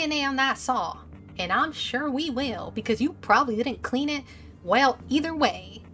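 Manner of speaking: measured Southern lawman pressing his case; Southern accent. Acted. Officer warning William about the evidence, Southern accent. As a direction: lay out the evidence with a Southern twang. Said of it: "is totally gonna have a Southern accent".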